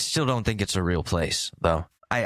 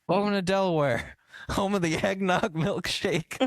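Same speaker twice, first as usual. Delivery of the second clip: put-on high, chummy old-man voice; a cheery ghost greeting visitors; hammed-up Biden welcoming tourists; ghostly Biden; 7 semitones higher than usual. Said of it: "Joe Biden's floating around".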